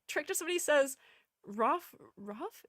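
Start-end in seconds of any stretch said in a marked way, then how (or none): 1.4-2.6 dog-like barking. Acted. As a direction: say it as a small dog's woof. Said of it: "that's more dog noises".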